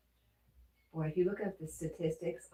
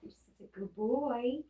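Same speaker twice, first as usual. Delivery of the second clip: higher, sing-song pet praise; light pet-talk praise; praising the dog; 7 semitones higher than usual. Real, not acted.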